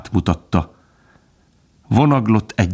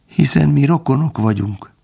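higher, humbly complaining plea; plaintive; 7 semitones higher than usual. A narrator's reading. Fox reminding dog they are kin, plaintive. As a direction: lament it, begging for mercy.

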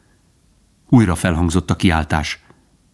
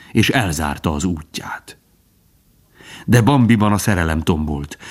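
usual speaking voice

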